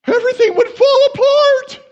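very high, loud shriek; mock panic. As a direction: wail it in hammed-up alarm. Mock panic about God's plan.

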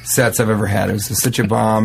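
normal talking voice

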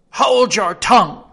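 the queen silencing Alice in fury. Acted. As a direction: snap it out in rage. Queen turns purple with rage.

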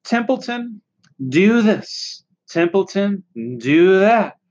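singsong list of nagging requests; mocking demands. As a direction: punch each order with scorn. Rat mocks others' requests.

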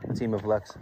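ordinary talk

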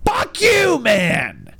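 put-on shouted high, cursing the girls; mock angry yell. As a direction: yell it like the angry honking friend. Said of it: "It's like".

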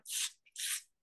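TZ as a sharp voiceless ts; tz sound. Shown as a demonstration. Teacher demonstrating the German letter, isolated ts. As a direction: hiss out "tz" without a vowel. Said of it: "se pronuncia".

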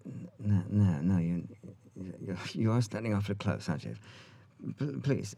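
softly stammered polite rebuff; lightly imitating an uneasy man, quiet and halting; hesitant, hushed mock objection; Sam Neill. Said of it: "and he said".

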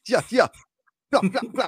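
high, nonsense mock Jamaican patter; silly put-on Jamaican accent; bad Jamaican accent. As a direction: babble in a hammed-up Jamaican accent. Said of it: "Your Jamaican accent is terrible".

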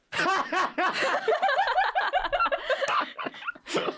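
evil chuckle; long high cackling villain laugh. Real, not acted. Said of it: "the perfect villain laugh".

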